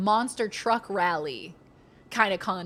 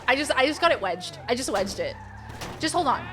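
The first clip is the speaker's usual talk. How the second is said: normal talking voice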